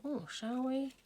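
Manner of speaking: playful high-pitched indecision; musing aloud. Retold or imitated. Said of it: "I just thought".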